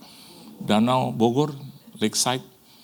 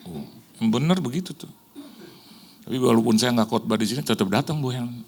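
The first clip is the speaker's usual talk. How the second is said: ordinary talk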